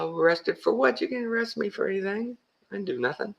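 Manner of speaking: high, incredulous retort; mock outrage at being threatened; indignant comeback. His indignant reply.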